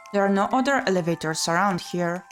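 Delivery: ordinary talk, nothing marked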